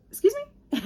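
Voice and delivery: high, loud, startled question; pitched-way-up incredulous retort; mock disbelief. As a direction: imitate someone utterly taken aback. Acts her startled reply to confession.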